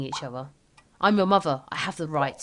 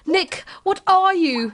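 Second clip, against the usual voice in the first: slow, high, loud nick what are you; a shocked mother's cry; mother confronts son in alarm; alarmed demand; 9 semitones higher, 5 dB louder, slower than usual. Acted. Mother demands son's identity.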